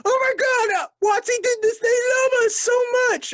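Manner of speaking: very high, loud, gushing squeal; mock an overexcited fan; gushing fanboy. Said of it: "it's always like".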